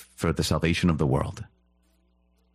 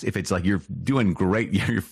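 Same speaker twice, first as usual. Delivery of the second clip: ordinary talk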